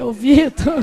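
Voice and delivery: exasperated laugh on the name; amused scolding that dissolves into laughing. Retold or imitated. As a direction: break into laughter while calling him out. Laughing at her son's attempt.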